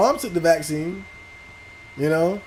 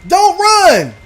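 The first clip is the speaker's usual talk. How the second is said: yell; 10 semitones higher, 7 dB louder than usual. Real, not acted. Yelling at game enemy.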